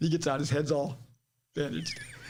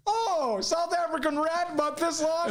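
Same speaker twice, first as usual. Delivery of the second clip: mock coworker voice; 11 semitones higher, slower than usual. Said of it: "And he goes".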